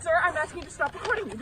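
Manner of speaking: loud, sharp order to stop filming; angry loud demand. Real, not acted. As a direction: tell him off loudly. Woman confronts man filming.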